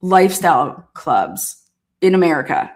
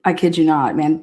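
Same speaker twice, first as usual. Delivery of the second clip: ordinary talk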